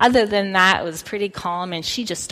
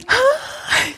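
high mock panicked cry, much higher. Mom imitating her panicking daughter's high cry. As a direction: imitate a terrified child's wail. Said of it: "she's like".

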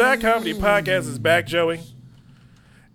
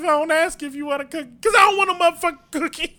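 angry shout; 8 semitones higher, faster than usual. Retold or imitated. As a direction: bellow it furiously, half laughing. Angry retold retort, laughing.

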